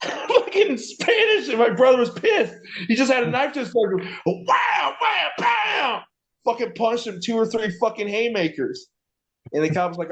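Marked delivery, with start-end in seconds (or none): 0.0-4.0 laughing through the words, pitch way up; cracking up while telling it. Real, not acted. Laughing hard while telling his friend about the cop. 4.2-6.0 high, excited sound-effect pow. Retold or imitated. Acting out his brother's punches for his friend, excited shout.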